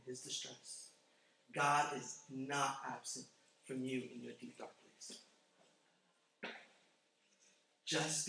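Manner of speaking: slow hushed reassurance, fading to a whisper. Acted. Pastor hushing the room to reassure the hurting. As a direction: soften and slow to a near whisper.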